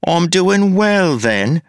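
put-on a sneering copy of his accent; mocking parody voice. A narrator's reading. The double needles Wayne, high mocking voice.

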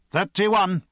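high, loud time call. A narrator's reading. Pierce reading the stopwatch to Ager, called out. Timed drill, calling out time.

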